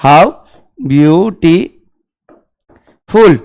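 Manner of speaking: very slow, drawn out "How beautiful"; slow demo. Shown as a demonstration. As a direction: stretch each word for students. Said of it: "तो बोलेंगे क्या". (बहुत धीरे खींचकर)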